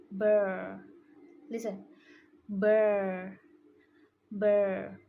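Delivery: burr with a heavy American r; strong American R. Shown as a demonstration. Student showing the American r to the class. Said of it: "American accent uses R stronger".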